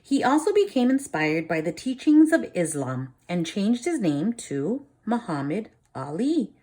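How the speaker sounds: lively storybook reading for a class; reading to children. A narrator's reading. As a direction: read it like a teacher reading a picture book to the class. Reads picture book aloud.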